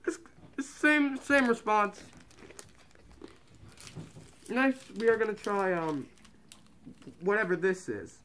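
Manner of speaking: muffled through cloth mask; speaking behind fabric covering; through a face mask. Real, not acted. Seen 1: Mask pulled back up after tasting; stays over mouth to end.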